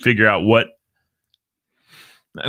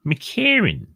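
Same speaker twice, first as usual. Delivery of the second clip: McCarran said higher, lilting; raised McCarran; 11 semitones higher than usual. Shown as a demonstration. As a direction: sing out McCarran high. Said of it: "It's McCarran".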